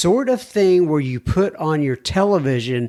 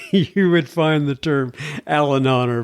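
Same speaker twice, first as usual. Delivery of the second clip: laughing. Real, not acted. Amused at made-up word.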